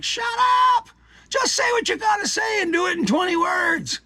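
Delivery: high, hammed-up shout at rambling writers; imagined scream. Fed up with wordy podcasters, he screams at them. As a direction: yell it like you've lost patience. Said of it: "you just wanna scream at them".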